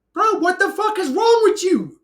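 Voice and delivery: high, loud, disbelieving yell; outraged shout. Real, not acted. Outraged outburst at God.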